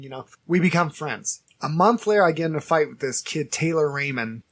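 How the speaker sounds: normal talking voice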